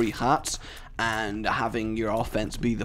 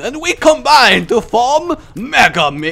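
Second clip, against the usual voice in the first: loud high hammy hero shout; announcer voice; 11 semitones higher, 11 dB louder than usual. Pretend. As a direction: boom it like a cartoon team. Midgets announce combining.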